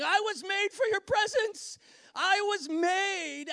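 loud, high impassioned cry; body crying out. Acted. Preacher voicing the body's longing for God, crying out. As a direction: proclaim it with aching full force. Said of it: "every part of our body under the curse is going".

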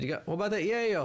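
high-pitched mock dealer's offer; imitated Rasta hustler. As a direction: make a pushy pitch in a put-on high voice. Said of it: "We had this Rasta guy come up".

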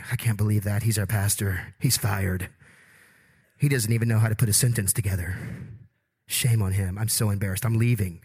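grumbling grammar snobs. Retold or imitated. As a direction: play the outraged grammar purist, deep and huffy. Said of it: "grammar people are in here going".

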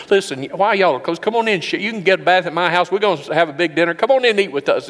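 high, loud, eager invitation; hearty welcome. Retold or imitated. Host calling shepherds in to dinner, high eager welcome. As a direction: call them in like a beaming host.

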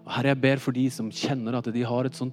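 hushed, earnest public prayer; leading prayer. Real, not acted. Preacher thanking and asking the Lord for guidance, praying. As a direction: pray it gently, in short phrases with pauses.

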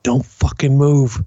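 slow tense warning. Retold or imitated. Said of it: "everybody's like".

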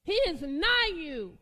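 loud, slow, drawn-out declaration; preacher's booming cry; full-force preaching. Acted. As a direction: proclaim it to the room. Preaching burst.

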